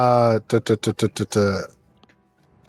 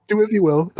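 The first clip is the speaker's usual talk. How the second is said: mock grand; 8 semitones higher than usual. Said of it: "He was so enthusiastic".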